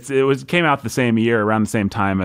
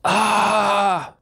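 spooky scream; 10 semitones higher than usual. Acted. Scream after donor name.

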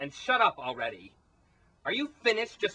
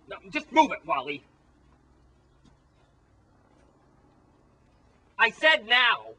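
bossy order; 7 semitones higher, 5 dB louder than usual. Acted. Tina ordering Wally to hurry.

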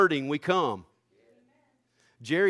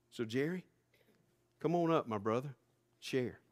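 soft invitation; 2 semitones lower, 8 dB quieter than usual. Real, not acted. Calling Jerry up quietly.

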